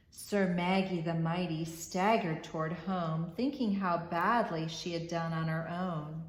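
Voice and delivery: ordinary delivery, nothing marked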